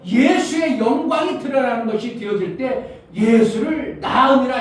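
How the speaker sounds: high, forceful sermon delivery; impassioned preaching. Real, not acted. Preacher urging the congregation to show Jesus' glory, impassioned. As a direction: drive each point home to the congregation.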